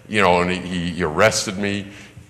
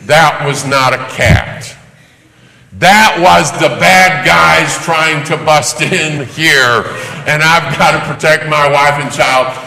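rising alarm; 5 semitones higher, 6 dB louder, slower than usual. Retold or imitated. Waking to danger, rising alarm.

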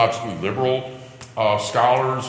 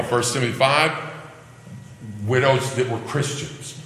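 ordinary delivery

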